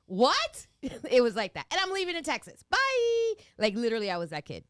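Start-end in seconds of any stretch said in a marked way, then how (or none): 0.1-0.7 shocked. Retold or imitated. Mother shocked by marriage news. 1.7-3.3 defiant. Retold or imitated. Defiant teen leaving home.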